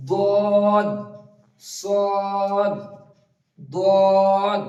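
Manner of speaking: দদ and সদ called out loud and heavy; emphatic dad and sad drill. Shown as a demonstration. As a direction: drill দদ, সদ, দদ firmly for students. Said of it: "উচ্চারণ করুন আমার সঙ্গে সঙ্গে". (জোরে ভারী উচ্চারণে দদ, সদ, দদ)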